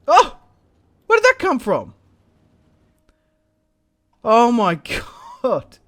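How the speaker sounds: loud, high-pitched shock at an ambush; shocked surprise. Real, not acted. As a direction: blurt it out, caught completely off guard. Enemy paratroopers appear suddenly.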